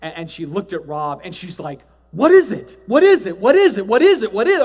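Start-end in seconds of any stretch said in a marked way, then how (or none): 2.1-4.6 higher, louder urgent repeated question; frantic retold questioning; frantic asking. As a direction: press for an answer in a panic. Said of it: "she's just almost frantic".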